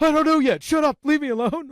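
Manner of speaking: very high, loud mock-panicked squawk; flustered teenager. Flustered teenager fending off teasing, high mock squeal. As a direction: imitate an embarrassed teen squealing in protest.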